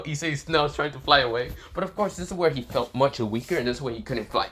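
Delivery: usual speaking voice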